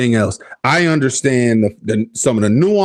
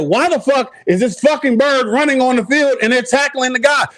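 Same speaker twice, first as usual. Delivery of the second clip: 1 exasperated outburst; 12 semitones higher than usual. Retold or imitated. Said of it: "And I'm sitting there".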